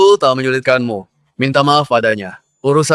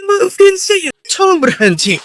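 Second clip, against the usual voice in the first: pleading; slower than usual. Acted. Beaten fighter begs for mercy.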